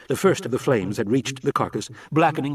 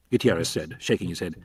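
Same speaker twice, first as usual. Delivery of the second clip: ordinary delivery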